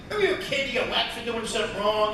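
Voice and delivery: ordinary delivery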